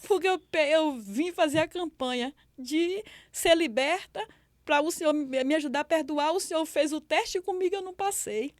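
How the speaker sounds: reliving a desperate plea to God, climbing high; anguished prayer. Retold or imitated. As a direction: plead with God, rising into a high, loud cry. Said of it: "falando com Deus desse jeito". (súplica angustiada, voz subindo)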